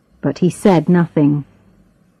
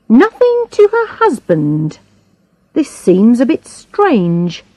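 lightly voiced inspector, lively and deliberate; probing inspector; 6 semitones higher than usual. A narrator's reading. Inspector laying out a murder motive to the suspects, probing. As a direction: lean on each point with swooping emphasis.

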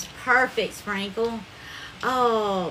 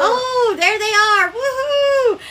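высокий громкий радостный вскрик; кричит от восторга, встречая гостей; excited cry; 10 semitones higher, 8 dB louder, slower than usual. Real, not acted. Celebrating live Happy Friday arrival.

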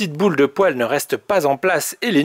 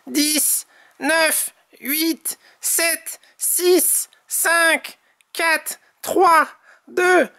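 Tom's countdown; 11 semitones higher, much slower than usual. A narrator's reading. Tom announces the vanishing rabbits, high countdown building to a shout. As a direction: count down high, swelling to a shouted final word. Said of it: "annonce Tom".

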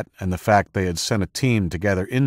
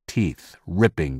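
ordinary delivery, nothing marked

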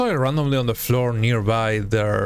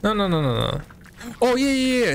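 usual speaking voice

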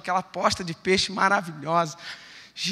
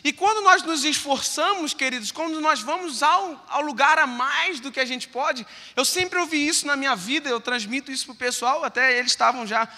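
fervent preaching; 8 semitones higher, 6 dB louder than usual. Acted. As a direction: drive the message home from the pulpit. Preaching at full force. (pregação fervorosa, mais aguda e lenta)

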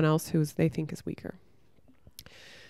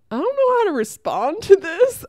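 much higher and louder, incredulous; higher, louder disbelief at a bully in the sauna; stunned disbelief; 12 semitones higher, 7 dB louder than usual. Retold or imitated. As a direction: react as if you can't believe what you just heard. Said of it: "I was just like".

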